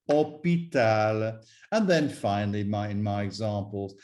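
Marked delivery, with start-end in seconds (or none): 0.1-1.4 "hôpital" slowly in French, no h and no s; French pronunciation. Shown as a demonstration. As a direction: say "hôpital" as o-pee-tal, drawn out. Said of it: "an S, such as hospital".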